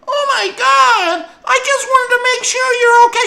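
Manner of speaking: very high, loud hurt mother; startled mom. Retold or imitated. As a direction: sound like a shocked, wounded mom. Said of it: "her mom was like".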